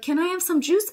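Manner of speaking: high, rising ask for the bird; sweet asking voice. Retold or imitated. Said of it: "I'd be like".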